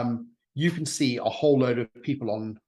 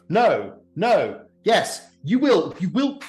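high, loud, sputtering fury; incandescent rage; 6 semitones higher, 3 dB louder than usual. Acted. Razzia loses her temper at the party, raging. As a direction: splutter with rage, losing words. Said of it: "really incandescent with rage".

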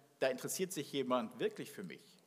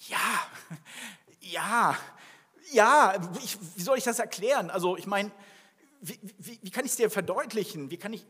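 rising, louder yes, yes, yes, then tumbling fast words; flustered stammering; 4 semitones higher, 5 dB louder than usual. Retold or imitated. Peter pressed by Jesus's question, stammering and flustered. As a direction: stumble over yourself trying to explain, getting louder. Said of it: "Ich werde vielleicht rumstammeln".

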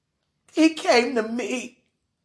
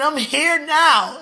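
ordinary talk

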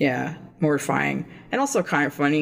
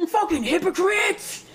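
slow, higher, laughing it out; 14 semitones higher than usual. Real, not acted. Calling themselves out while still laughing. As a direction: chuckle the words at yourselves. Mocking own podcast plug, laughing.

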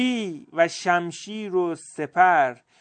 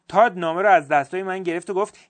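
ordinary delivery

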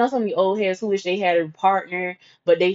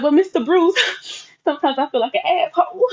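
mock teary confession; 7 semitones higher than usual. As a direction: whimper it out like a guilty kid. Confessing guilt to mentor.

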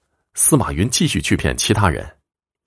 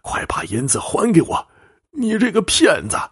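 put-on high, strained angry old man; fierce accusation; 12 semitones higher than usual. Cheated old man confronting the fortune-teller, fierce and high-pitched. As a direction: demand it back, pitch climbing sharply. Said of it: "老者气势汹汹地说".

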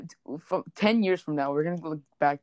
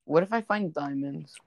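ordinary delivery

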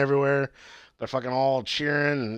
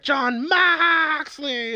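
high, drawn-out mock yell of a name; imitated yell; 3 dB louder, much slower than usual. Retelling Jericho calling out to his rival, drawn-out mock yell. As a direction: stretch the name out in a high-pitched holler. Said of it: "He was like".

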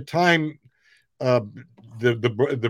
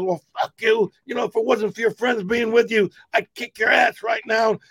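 high, shouted tough-guy threat; imitating the angry guy threatening; 9 semitones higher than usual. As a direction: bark it as he backs off. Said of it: "the guy goes".

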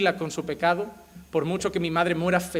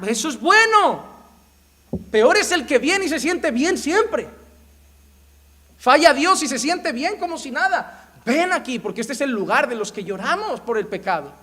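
fervent preaching; 9 semitones higher, 7 dB louder than usual. Acted. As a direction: proclaim it from the pulpit. Preaching at full force. (predicación fervorosa y muy alta)

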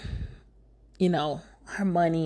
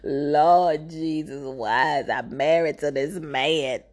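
slow, low mock lament; woe-is-me wail; 4 semitones lower, slower than usual. Wife crying on the couch, mock woe-is-me lament. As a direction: drag it out in hammed-up despair. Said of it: "Oh, woe is me" mode".

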